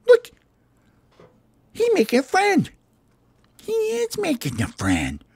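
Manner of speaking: very high cartoon bird voice; little parakeet voice. Parakeets spot their pal with the cook, high bird squeal.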